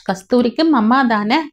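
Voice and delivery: plain reading voice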